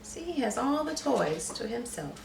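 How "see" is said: ordinary talk, nothing marked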